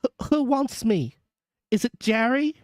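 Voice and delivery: high, hesitant, nervous passenger; mildly quavering. A narrator's reading. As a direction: stammer slightly with unease. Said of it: "with mildly quavering speech".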